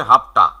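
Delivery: "হাফটা" as short "hafta", f in place of v; contracted form. Shown as a demonstration. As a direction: run have and to together as "হাফটা". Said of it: "have to-টাকে সংক্ষেপে".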